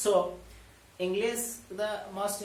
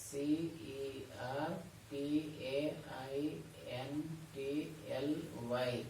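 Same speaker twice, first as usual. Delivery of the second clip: slow spelling; 3 semitones lower, 6 dB quieter than usual. Shown as a demonstration. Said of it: "sorry, just".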